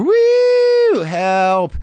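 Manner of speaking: high, loud woo turning into a cry for help; desperate woo. Retold or imitated. Comic decoding the woo, loud high cry for help. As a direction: yelp it like a plea.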